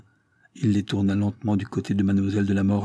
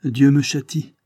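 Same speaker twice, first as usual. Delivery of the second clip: raised, louder anguished cry; stricken confession; 5 semitones higher, 6 dB louder than usual. A narrator's reading. Sipierre, shattered, admits her punishment. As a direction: confess as if struck down. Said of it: "reprit-elle".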